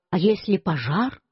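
ordinary delivery

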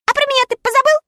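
high, hurt puppy voice; offended little dog. A narrator's reading. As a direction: sulk like a slighted little dog. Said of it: "обиженно сказал Татошка".

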